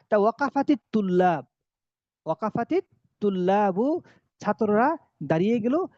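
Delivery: ordinary delivery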